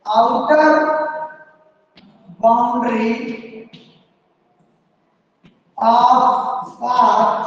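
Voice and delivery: one word at a time, long pauses between; slow dictation. Real, not acted. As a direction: dictate slowly so the class can write. Teacher dictates after saying write. (एक-एक शब्द रुक कर लिखवाते हुए)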